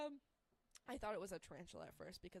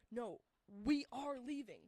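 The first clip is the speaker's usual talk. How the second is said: firm refusal; 3 semitones higher, 6 dB louder, slower than usual. Retold or imitated.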